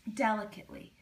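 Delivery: plain reading voice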